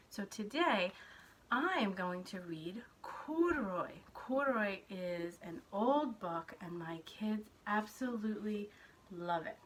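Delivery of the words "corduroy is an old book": plain reading voice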